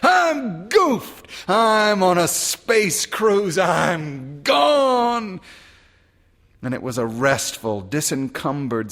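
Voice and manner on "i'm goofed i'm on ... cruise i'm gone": high, loud, exultant shout; yelled. A narrator's reading. Soldiers yelling goodbye to the war, much higher joyful shout. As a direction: hurl it into the wind. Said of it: "they yelled".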